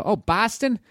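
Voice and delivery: high, rising Boston with a flat a; high exaggerated Boston question; mocking Boston accent. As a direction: repeat Boston back as a mock. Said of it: "did I say it like that".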